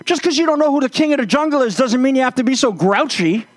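high, huffy lion voice with a laugh; indignant lion. Acted. Dusted-off lion to the elephant: high, huffy laughing lion voice. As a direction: brush off the defeat with wounded pride. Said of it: "he says".

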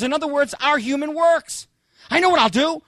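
ordinary talk

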